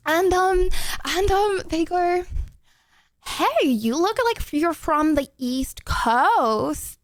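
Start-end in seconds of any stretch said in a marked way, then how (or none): 3.3-7.0 put-on slow, overdone American accent, words stretched out. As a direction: drag out a clumsy American accent. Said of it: "the accents got worse because I started talking slowly".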